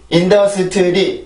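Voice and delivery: 인더스트리 slow, every syllable even; Korean five-syllable industry. Shown as a demonstration. Teacher showing the Korean way of saying industry. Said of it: "이렇게 오음절이죠".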